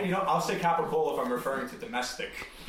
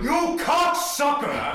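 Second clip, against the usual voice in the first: loud, high, drawn-out insult; put-on explosive yell; Danny Aiello; 12 semitones higher, 7 dB louder, slower than usual. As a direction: holler the insult slowly, pitched way up. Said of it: "I'm just doing Danny Aiello".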